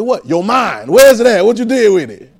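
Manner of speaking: mock mom asking where your mind is; lightly voiced scolding mom. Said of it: "my mom used to say".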